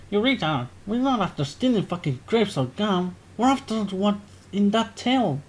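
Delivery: mock-sneer at a daft idea; scornful British lad. Jack mocks Waffle Sniff's plan, scornful British lad.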